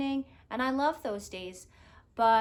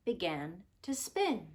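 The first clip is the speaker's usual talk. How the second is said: put-on expressive picture-book narration for children; gentle storyteller voice; storybook read-aloud; 2 semitones higher, 7 dB louder than usual. A narrator's reading. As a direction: tell it like a bedtime story.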